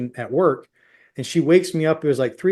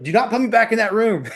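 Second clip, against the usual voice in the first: emphatic high-pitched protest, retold; firm refusal; 8 semitones higher than usual. Husband refuses the nurse's waiting room, high and emphatic. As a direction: refuse insistently, pitch jumping up.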